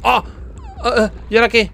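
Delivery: high, loud yelps into a question; startled panic. Real, not acted. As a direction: cry out, then ask in a panic. Startled, unsure what to do. (pánico agudo y fuerte)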